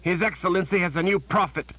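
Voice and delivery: pained outcry; cried out. A narrator's reading. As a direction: call it out in pain. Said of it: "Warren cried out".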